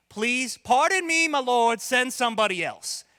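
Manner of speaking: hammed-up British begging; British accent. Reluctant person begging God to send another, mock British accent. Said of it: "read it in a British accent".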